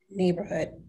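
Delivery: ordinary delivery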